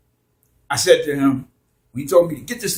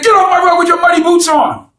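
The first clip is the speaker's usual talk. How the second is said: much higher, louder angry yell; yelling at him; 10 dB louder than usual. Retold or imitated. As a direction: bark it like scolding your son. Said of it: "I yelled at him like I yell at my son".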